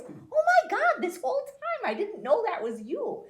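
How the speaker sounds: high, loud retold disbelief; hammed-up amazement. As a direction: exclaim like a client who just found out. Client's amazed realization, retold.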